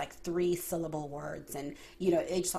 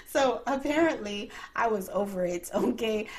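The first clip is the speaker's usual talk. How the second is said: words spilling through laughter, raised and louder; laughing; 4 semitones higher, 5 dB louder than usual. Real, not acted. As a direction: talk while still laughing. Still laughing from the story.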